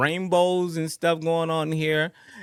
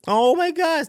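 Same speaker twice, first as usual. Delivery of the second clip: ordinary talk, nothing marked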